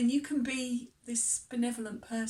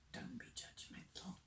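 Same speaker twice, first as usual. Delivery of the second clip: soft unvoiced whisper, much softer; whispered aside; 3 semitones higher, 17 dB quieter than usual. Reminding pilgrims what the Camino teaches, whispered. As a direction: murmur it like a private reminder.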